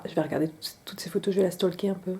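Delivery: quick conspiratorial whisper. Real, not acted. Vlogger confessing to viewers she'll stalk the influencer, whispered. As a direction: whisper it like a guilty secret.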